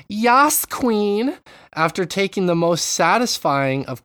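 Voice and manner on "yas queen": sassy Yas queen. Retold or imitated. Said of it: "a man that says".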